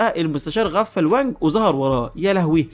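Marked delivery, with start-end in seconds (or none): none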